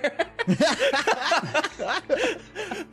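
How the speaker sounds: high hearty merchant laugh; hearty laugh of a salesman. Acted. Merchant laughs after the outburst.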